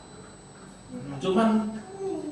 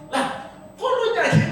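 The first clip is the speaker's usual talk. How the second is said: surprised; 13 semitones higher than usual. Retold or imitated. Surprised the Bugis driver sings.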